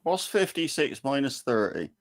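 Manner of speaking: ordinary talk, nothing marked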